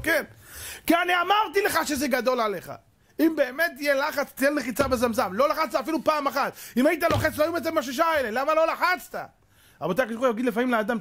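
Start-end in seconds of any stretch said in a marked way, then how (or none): 0.0-9.3 high, stern reproach; scolding manager. Retold or imitated. Manager rebuking the doctor, high and scolding. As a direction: scold him for never pressing. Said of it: "אמר לו".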